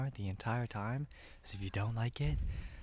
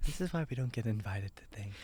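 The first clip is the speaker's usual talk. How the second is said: ordinary talk, nothing marked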